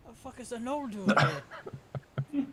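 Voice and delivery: loud, high, drawn-out disbelief; acted incredulous outburst; baffled sailor. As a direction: exclaim slowly at the weird newcomer. Sailor baffled by odd insult.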